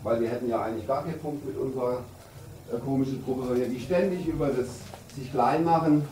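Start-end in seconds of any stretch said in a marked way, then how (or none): none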